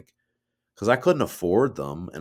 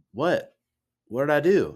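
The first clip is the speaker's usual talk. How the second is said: bewildered reply; 7 semitones higher, 3 dB louder than usual. Retold or imitated. Own bewildered reply to dad, retold.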